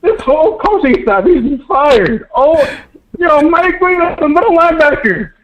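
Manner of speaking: real exasperated high-pitched yelling, laughing in disbelief; crashing out. Real, not acted. Caller ranting to host about the coaching, exasperated yelling. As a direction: rant at the top of your voice, half laughing, half crying. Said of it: "never seen Cam crash out like that".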